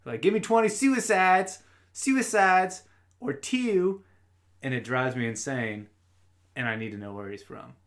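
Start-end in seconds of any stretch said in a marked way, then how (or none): 0.2-4.0 loud, high mock Baltimore coach; imitated Baltimore accent. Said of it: "subtle Baltimore accent".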